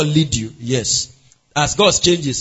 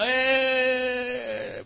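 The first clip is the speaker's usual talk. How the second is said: long, held hesitant "eh"; drawn-out "eh"; 6 semitones higher, 5 dB quieter than usual. Acted. Divorcing spouse asked how it started, drawn-out hesitation. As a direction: stall with a stretched-out "eh". Acting couple hesitating over question.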